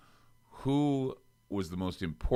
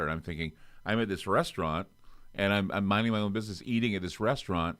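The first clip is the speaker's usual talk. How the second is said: ordinary talk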